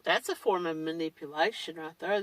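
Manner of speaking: ordinary talk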